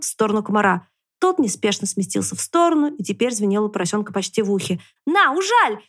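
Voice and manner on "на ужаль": high piglet challenge; high-pitched taunt; defiant cry. A narrator's reading. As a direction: cry it out high, daring the mosquito. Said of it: "крикнул ему поросёнок".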